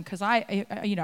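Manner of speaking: usual speaking voice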